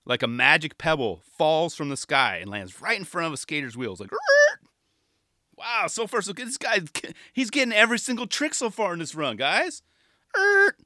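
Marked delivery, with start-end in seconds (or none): 4.1-4.6 sharp high squeal of a wheel stopping; mock screech as the skater bails; short high mock screech; high screech noise. Imitated skate slip noise. 5.6-9.8 higher, eager play-by-play voice; higher eager mock commentator. Mock commentator building hype. 10.4-10.8 sharp high squeal of a wheel stopping; mock screech as the skater bails; short high mock screech; high screech noise. Imitated skate slip noise.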